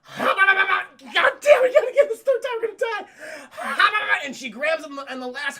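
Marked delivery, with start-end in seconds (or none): 0.0-1.1 loud, high effort noises; comic straining grab noise; mock frantic babble. Imitating another frantic grab. 1.1-3.1 high, loud panicked wail; hammed-up terror, pitched way up; mock panic. Acting character about to fall. 3.5-4.2 loud, high effort noises; comic straining grab noise; mock frantic babble. Imitating last frantic grab.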